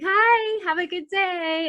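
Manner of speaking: high, sing-out hello; happy, high-pitched greeting; cheery greeting. Retold or imitated. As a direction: greet passers-by, giddily friendly. Happily greeting passers-by.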